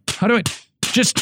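high, mock-whiny pleading; hammed-up confusion at a paper that won't scroll; confused screen addict. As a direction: whine like the paper should be a touchscreen. Pretending to swipe a newspaper.

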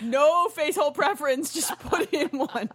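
slow, giggling tease; laughing. Real, not acted. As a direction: laugh through the words. Teasing a crude joke, laughing.

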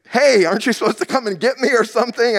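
high, quick mock protest; indignant retold thought. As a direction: act out an affronted plea to be rescued. Acting out indignant thought at instructor.

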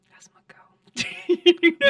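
slow breathy whisper, high; whispered quote. Retold or imitated. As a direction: whisper it like a proud dad. Father's approving whisper retold.

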